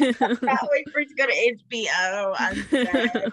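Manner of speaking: real laughing fit over the words; cracking up. Real, not acted. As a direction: talk while laughing hard. Cracking up at co-host's joke.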